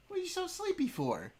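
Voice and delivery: high sing-song tease; high teasing pet talk. Real, not acted. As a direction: tease the sleepy dog, high. Teasing dog.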